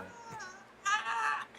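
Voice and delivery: imitated high-pitched wail; freaking out. Acting out the injured friend freaking out, high mock wail. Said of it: "freaking out".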